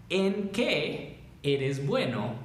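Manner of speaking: slow, in two separated chunks; slow clear rephrasing. Shown as a demonstration. Teacher reordering the question for students, slow and clear. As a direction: set out the reordered question slowly. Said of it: "Reorganícenlo mentalmente para que".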